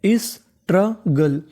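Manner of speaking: drawn-out careful pronunciation; slow model word. Shown as a demonstration. Teacher drawing out struggle slowly for the class. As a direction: stretch the word struggle out slowly, sounding each part. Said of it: "क्या बन गई meaning?".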